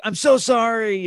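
high-pitched, gushing apology; pleading high sorry, re-enacted; retold apology. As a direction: act out apologizing profusely, voice jumping up. Retold apology on reaching car.

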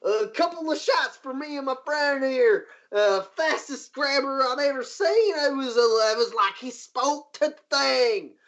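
high, amazed patron voice; excited bar patron. Acted. Patron marvelling at the near miss, high amazed voice. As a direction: marvel like a rowdy local.

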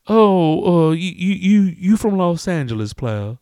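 loud, high stuttering customer; impressed customer. Acted. Customer guessing the stranger's hometown, loud high excitement. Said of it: "and he's all".

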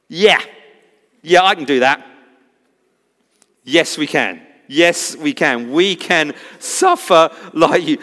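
gung-ho yes, we can; eager boast. Retold or imitated. Disciples eagerly boasting, mimicked.

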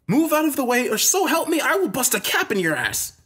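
very high, strained furious warning; angry threat. Acted. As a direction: threaten her to clear the way. Threatens the woman blocking his path.

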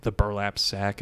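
normal talking voice

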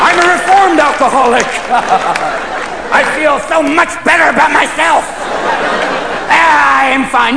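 high, forced insistence on being fine; mock cheerful recovery. Reformed alcoholic reassuring everyone, high mock cheer. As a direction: pitch it up, overdoing the wellness.

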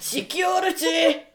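high, drawn-out mock shout; hammed-up cry for help at a bad pickup; calling security. In role, calls for security.

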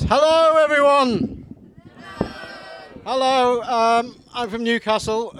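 comic bellowing hello to the audience; loud greeting. Real, not acted. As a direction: boom it out to the back row. Comic greeting the crowd loudly.

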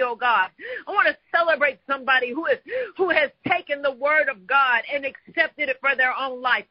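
ordinary talk

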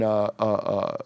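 ordinary talk

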